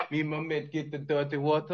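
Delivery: put-on British accent slipping into Jamaican; badly faked British accent with a Jamaican lilt; attempted British accent. Host asked for British, botched British accent sounding Jamaican. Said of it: "What's your British accent?".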